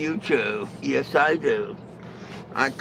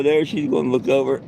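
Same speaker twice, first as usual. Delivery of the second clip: ordinary delivery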